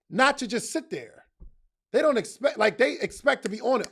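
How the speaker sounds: usual speaking voice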